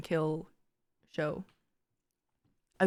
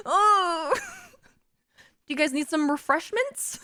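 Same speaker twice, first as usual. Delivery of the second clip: much higher, chirpy, awkward offer; awkward hostess; 9 semitones higher than usual. Pretend. Left out of the threesome, offering drinks, awkwardly chirpy. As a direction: cover the awkwardness with fake cheer. Third wheel offering refreshments.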